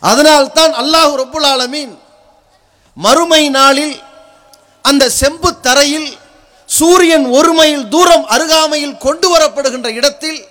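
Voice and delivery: normal talking voice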